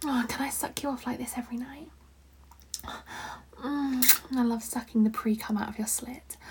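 soft ASMR whisper. Acted. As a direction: breathe the words close to the mic. Whispered roleplay.

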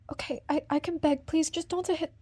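ordinary talk

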